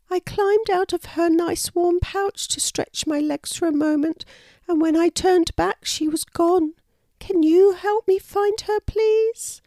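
put-on very high baby-animal voice, pleading at the end; high-pitched, upset storybook youngster; sad little wallaby. A narrator's reading. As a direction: play a lost little wallaby asking for help. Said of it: "replied the wallaby sadly".

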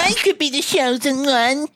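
high, whiny wannabe-hero voice. Retold or imitated. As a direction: whine it like a wimp. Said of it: "He kinda talks like that, with, like, a whiny voice".

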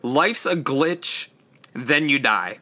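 said slowly and deliberately, word by word; slow, careful correction. Shown as a demonstration. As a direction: carefully get the title right this time. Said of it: "I'm stumbling over all of my words".

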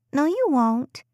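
put-on high, cheeky little girl voice; teasing child. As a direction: tease back like a grinning kid. Playful child teasing mother.

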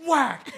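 loud, high imitated smack; laughing sound effect of a hit; mock whack. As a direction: slap the sound out.

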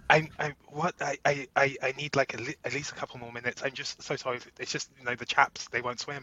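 very soft, stammering apology; ineffectual Brit. Pretend. Man in role play stalling sperm bank worker, meek stammer. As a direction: dither meekly and over-apologise, pretend flustered. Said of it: "I become very, like, ineffectual".

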